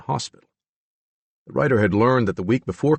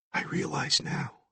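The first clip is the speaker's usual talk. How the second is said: soft unvoiced whisper; 7 semitones higher, 10 dB quieter, slower than usual. A narrator's reading. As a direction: whisper it slowly. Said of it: "Billy whispered".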